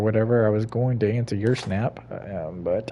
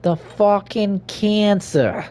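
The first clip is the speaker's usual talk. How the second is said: drawn-out mock lament; 9 semitones higher, 3 dB louder, much slower than usual.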